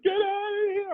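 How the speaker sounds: very high mock child screech; shrieking kid. Kid driving off the neighbour, high-pitched screech. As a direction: imitate a kid yelling to be left alone. Kid yelling through door, retold.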